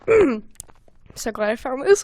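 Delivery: ordinary talk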